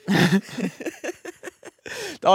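squealing high-pitched laugh. Real, not acted. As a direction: break into a high giggle. Laughing at the joke.

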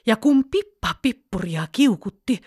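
plain reading voice

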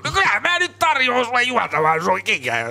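imitating Jukka Virtanen. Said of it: "että sä koko ajan painostat".

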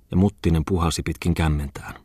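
usual speaking voice

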